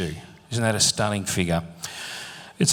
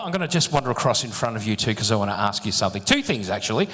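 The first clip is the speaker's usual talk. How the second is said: ordinary delivery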